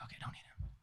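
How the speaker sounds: soft quick whisper. Retold or imitated. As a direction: brush it off under breath. Nurse replies curtly.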